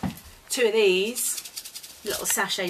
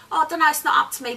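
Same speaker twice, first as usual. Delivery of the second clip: fast, louder East London waitress put-on; mock East London accent; 4 semitones higher, 5 dB louder, much faster than usual. Imitating an East London waitress shrugging off a customer, fast East London accent. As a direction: rattle it off in a quick East London twang. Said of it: "had she been from East London, she would".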